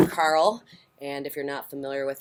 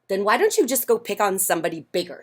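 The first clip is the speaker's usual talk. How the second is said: quick, higher, loud comeback; friendly ladybug retort; 5 semitones higher, 5 dB louder, faster than usual. Acted. Friendly ladybug telling grouchy one to pick on bigger, quick. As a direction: toss the challenge back, brisk.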